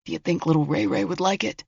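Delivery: quiet, cold menace; voice of a killer. Acted. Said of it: "The voice of a killer".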